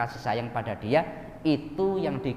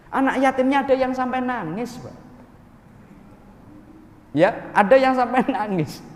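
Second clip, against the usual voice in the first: much higher and louder, laughing in disbelief; high amused disbelief at crying orphans; incredulous; 9 semitones higher, 4 dB louder than usual. Real, not acted. Preacher tells congregation orphans cried, high amused disbelief.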